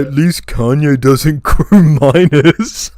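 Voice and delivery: loud, high, drawn-out mocking delivery; exaggerated sarcastic internet-troll mockery. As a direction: sneer it slowly and loudly, stretching the words. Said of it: "supposed to be, like, sarcastic".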